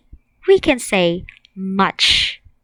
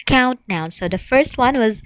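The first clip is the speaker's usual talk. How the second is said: ordinary delivery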